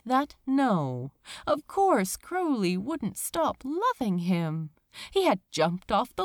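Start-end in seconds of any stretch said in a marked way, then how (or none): none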